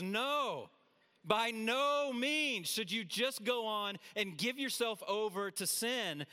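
high, drawn-out "No", then slow "by no means"; emphatic refusal. Acted. Preacher acting out Paul's answer on sin, emphatic slow refusal. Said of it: "He's emphatic here. He's very clear".

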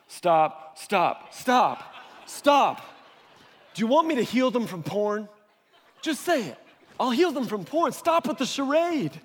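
repeated stops building to a high, fed-up plea; exasperated God. Acted. God interrupting wordy prayers, increasingly exasperated. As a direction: cut them off, rising in pitch as patience runs out.

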